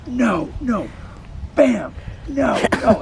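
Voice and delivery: high, loud sharp no, no; yelling at the dog. Retold or imitated. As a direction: stop the dog coming at you. Yelling commands at attacking dog.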